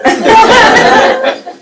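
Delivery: real high burst of laughter; bright amused laugh. Real, not acted. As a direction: laugh out loud at the memory. Laughing at her own story.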